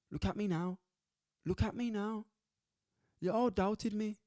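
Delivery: half-hearted Nigerian accent mocking a fighter's boast; poor Nigerian accent. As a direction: crude Nigerian accent, proud and gloating. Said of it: "Poor accent, isn't it?".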